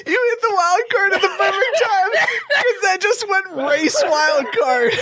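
high, thrilled wild-card shout; excited call. Real, not acted. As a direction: call it out like a big win.

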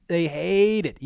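ordinary delivery

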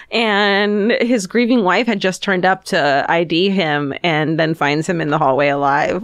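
ordinary talk, nothing marked

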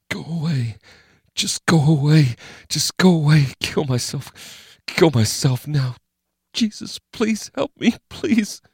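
high, thin, desperate whimper; panicked high voice, mostly hushed; terrified pleading. Acted. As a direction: beg and pray while trying not to be found. Terrified man hiding, praying.

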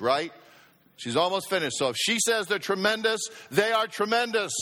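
ordinary talk, nothing marked

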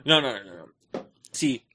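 ordinary talk, nothing marked

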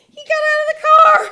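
high, loud, fast squeal; shrieking excitement at a sudden chance; elated realisation. Retold or imitated. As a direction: burst out with thrilled disbelief. Excited realisation repeated much louder.

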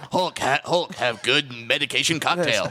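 dumb caveman Hulk talk. Pretend. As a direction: play Hulk, simple and blunt.

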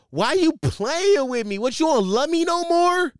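high, loud mock drunk woman yelling. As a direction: whine it up high like an angry drunk caller. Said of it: "you're yelling on the phone".

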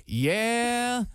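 long, high "yeah"; drawn-out "yeah". Shown as a demonstration. Showing his crutch phrase, a long drawn-out "yeah". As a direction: stretch "yeah" out as if stalling. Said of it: "lasts for, like, three seconds".

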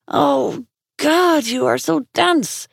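high, slow exasperated groan; exasperated woman. Acted. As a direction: drag it out, fed up.

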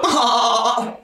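high whooping "Oh!" laugh; imitating a friend's fake laugh. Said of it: "had a fake laugh".

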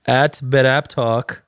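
slow, mock Iranian accent. Telling listeners where to reach out, mock Iranian accent. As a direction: put on a drawn-out Iranian accent. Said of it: "I'm gonna go Iranian accent".

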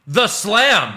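mock commentator booming the slam; Michael Cole. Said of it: "as Michael Cole called them".

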